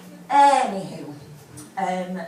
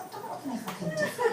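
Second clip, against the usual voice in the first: high, quick, hushed dismay; soft, squeaky inner grumble with a laugh; mock private dismay; 7 dB quieter, much faster than usual. Customer privately thinking at the hairdresser's mirror, mock dismay.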